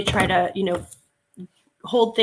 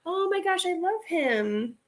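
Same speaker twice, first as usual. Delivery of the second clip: gushing; 9 semitones higher, 3 dB quieter, slower than usual. Real, not acted. Still gushing over owl.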